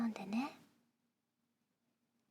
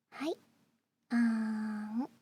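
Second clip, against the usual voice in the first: slow feeding coo; 2 semitones lower, slower than usual. Acted. Feeding the listener.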